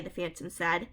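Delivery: ordinary delivery, nothing marked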